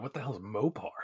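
hushed aside; whispering. Real, not acted. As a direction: say it under your breath. Hushed aside about Mopar.

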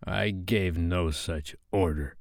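slow, soft, unnerved denial; subdued, uneasy captain; shaking a little. A narrator's reading. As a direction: deny it quietly, a little shaken. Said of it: "he said, shaking a little".

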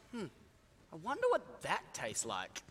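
quiet, low wondering to himself; musing George. Retold or imitated. George quietly pondering the fresh egg. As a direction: muse it under the breath. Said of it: "George thought to himself".

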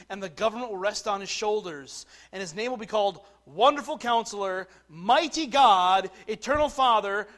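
loud scripture reading building on each title; proclaimed scripture. Real, not acted. Preacher reads Isaiah's titles to congregation, proclaimed scripture. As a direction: herald each name with mounting volume.

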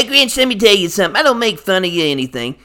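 usual speaking voice

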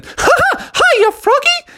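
very high squeaky cartoon mouse voice; Mickey. Mickey pouncing on Froggy, high cartoon mouse voice. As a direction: squeal a giggly cartoon hello.

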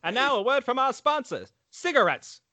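mock radio ad break; announcer voice.

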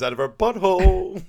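loud, hammed-up retort; mock outraged comeback. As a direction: belt out the punchline in mock outrage. Retelling a crude comeback, drawn out loud.

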